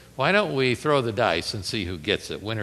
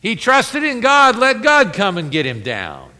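mocking taunt; 7 semitones higher, 7 dB louder than usual. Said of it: "quoted this".

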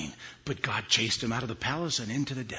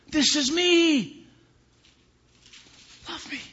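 high, loud, then fading to whisper; preacher speaking as God to listeners; impassioned preaching as God; 10 semitones higher, 10 dB louder, slower than usual. Real, not acted. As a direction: plead it, then soften. Preaching God's voice.

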